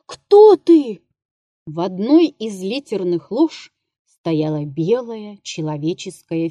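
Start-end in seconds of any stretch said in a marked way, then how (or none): 0.1-1.0 sharp, high, loud cry of fright; terrified scream. A narrator's reading. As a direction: scream it in a voice not your own. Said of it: "крикнул он резким, не своим голосом".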